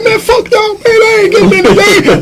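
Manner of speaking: high, sulky brush-off; bitter retort. Retold or imitated. Benched player tells boasting roommates off, high and sulky. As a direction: snap back, fed up.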